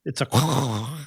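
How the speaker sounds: a playful mock-French "croissant" in a quack; duck-noise croissant. Shown as a demonstration. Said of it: "start making duck noises".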